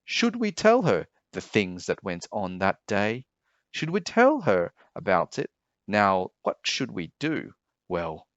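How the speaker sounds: plain reading voice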